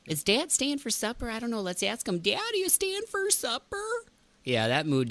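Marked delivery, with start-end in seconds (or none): 0.1-2.2 put-on high childlike Minnesota voice; small kid asking, pitched up. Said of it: "Remember that from Fargo?". 2.2-3.8 very high call to Dad. Retold or imitated. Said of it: "Remember that from Fargo?".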